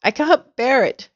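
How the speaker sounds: high-pitched storybook distress; crying out. A narrator's reading. Mrs Twit hearing her fate, crying out. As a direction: wail it lightly, pitched high. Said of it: "cried Missus Twit".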